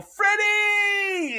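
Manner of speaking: high, drawn-out holler; calling out. Retold or imitated. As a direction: yell the name out the window to a friend. Calling out car window to friend.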